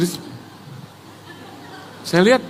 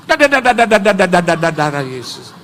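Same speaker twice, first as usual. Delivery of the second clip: mock latah stutter. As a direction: stammer it out in a startled, descending run. (meniru latah, gagap beruntun menurun)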